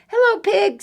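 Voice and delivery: high, loud duck voice; cheery duck greeting. Acted. Duck greets the pigs riding past, high duck call.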